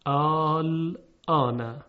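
آل آنه with long stretched آ; drawn-out Quranic reading. Shown as a demonstration. Teacher models the word آل آنه for students, stretched. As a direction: chant آل آنه slowly with a long madd. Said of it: "مثل کلمهٔ".

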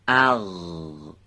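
اغ held long and rasped from the back of the throat; drawn-out guttural sound. Shown as a demonstration. Teacher demonstrating the letter ghain to the class, a long throaty اغ. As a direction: stretch اغ slowly, scraping deep in the throat. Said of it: "به صورت درشت و سایشی از".

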